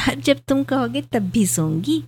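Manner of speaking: playful tease. A narrator's reading. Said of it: "Surabhi आंखें नचाकर बोली".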